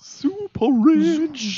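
drawn-out high. Pretend. Mock reaction to rich assumption.

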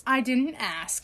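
slow, dry dismissal; deadpan. Acted. Cutting off her friend's birthday brag, slow deadpan. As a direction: brush her off slowly.

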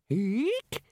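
very high short squeak; ape's eek. Acted. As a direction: squeak like the orangutan. Librarian's cry.

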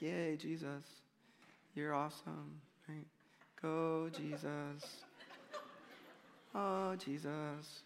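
soft, slow half-hearted cheer; mock a lifeless crowd, barely bothering; limp cheering. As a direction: feeble churchy cheering for Jesus. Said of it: "this is not what it was like".

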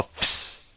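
"fish" with the i left out, fsh; vowelless fish. Shown as a demonstration. Punchline of the no-eyes joke, "fish" said as fsh. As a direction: deliver "fish" as a hissed fsh. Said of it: "but spelled without an I".

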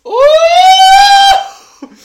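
high loud surprised ooh; squealed ooh. Real, not acted. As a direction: shriek it out. Sudden smell.

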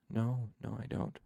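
soft, fading murmur; very quiet. A narrator's reading. Trappist talking to the baby, very quietly. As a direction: answer yourself softly. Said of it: "He spoke very quietly".

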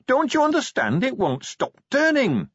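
high, pressing urgency; indignant Pony. Acted. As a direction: insist he grasp the wonder. Pony insists.

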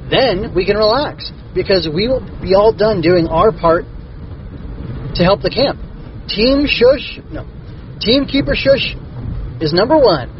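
put-on high eager kid voice; excited character voice. A narrator's reading. Slurp voiced in story.

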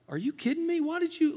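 high incredulous parent yelp; mock disbelief. Parent reacting to a child giving away lunch, in high mock disbelief. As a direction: pitch way up in disbelief at your kid.

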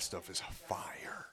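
slow, whispered aside. Real, not acted. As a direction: say it under your breath. Hushed aside about the sauce.

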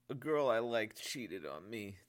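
put-on slow, tearful young voice; tearful confession. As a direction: confess it through tears. Sergeant confesses betrayal, crying.

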